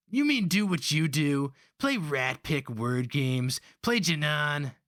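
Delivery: put-on high, indignant young voice; boy's bitter retort. A narrator's reading. Young Holka boy retorting bitterly to the slaver. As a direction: throw the word back at him.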